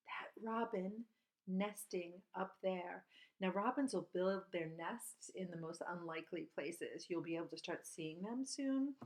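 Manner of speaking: ordinary talk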